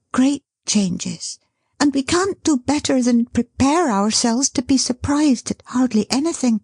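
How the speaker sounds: high, hurried nervous chatter; flustered chatter. Acted. Dot covering her agitation before the son appears, hurried. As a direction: keep talking to hide the nerves. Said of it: "talking on as fast as she could".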